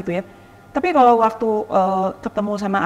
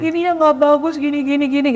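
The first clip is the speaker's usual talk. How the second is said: retold child's high-pitched whine; mock tearful complaint; 7 semitones higher, 4 dB louder than usual. Rich family's child complaining about the school, mock tearful. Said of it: "Dia nangis, dia bilang".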